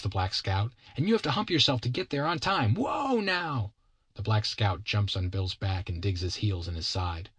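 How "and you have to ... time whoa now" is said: put-on high, quick child's voice; excited bossy kid. Kid rides Bill as a horse, high excited child voice. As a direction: drive your horse on like an eager boy.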